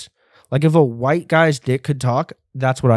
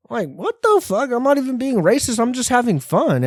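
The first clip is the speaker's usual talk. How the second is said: mock outrage; 10 semitones higher than usual.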